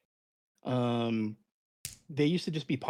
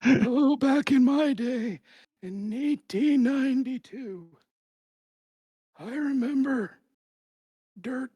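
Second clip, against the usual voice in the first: put-on old timer voice; 11 semitones higher, 4 dB louder, slower than usual. Old-timer joke after "back in the day.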